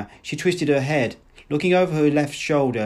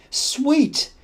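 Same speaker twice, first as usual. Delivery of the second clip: amorous endearment; 13 semitones higher than usual. A narrator's reading. Woman wooing the man.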